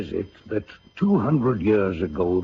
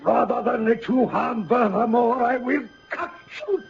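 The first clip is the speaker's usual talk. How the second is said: shouting defiance. Acted. General defying the vampire at his daughter's bed, shouting. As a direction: hurl it at her full force.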